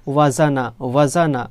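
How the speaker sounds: ওয়াজানা said as one smooth word, wa-ja-na run together; blended syllables. Shown as a demonstration. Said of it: "তিনটে মিলে কি হলো".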